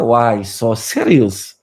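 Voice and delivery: low, very slow villain quote; slow, stretched, menacing imitation; Joker. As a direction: drawl it out low like a comic-book villain. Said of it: "Makanya kata Joker".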